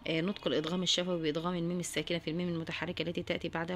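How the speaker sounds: ordinary delivery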